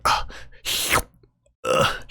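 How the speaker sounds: short grunts with sharp sucked-in air; grunt, then noisily suck air inward; imitated air sucking. Imitating friend sucking air in, strained grunts and gasps. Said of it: "you could hear air, like, suck in".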